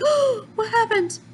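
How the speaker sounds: sudden shock at the explosion; shocked gasp. Retold or imitated. Everyone shocked after explosion.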